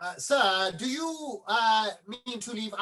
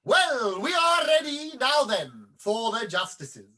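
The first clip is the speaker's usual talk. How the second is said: louder, higher call to the actors; 4 semitones higher, 6 dB louder, slower than usual. Acted. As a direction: summon the justices onto the stage. Author calls the next scene.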